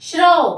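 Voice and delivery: "श्रौ" loud and high, ending in au; "श्रौ" as one ringing syllable; model pronunciation. Shown as a demonstration. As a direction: sound out "श्रौ" emphatically for learners. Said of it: "इसका उच्चारण होगा".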